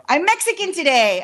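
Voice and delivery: loud, high, proud announcement; joyful loud boast; excited shout. Acted. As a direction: belt it out like a proud declaration. Joking exclaim, then repeated plainly.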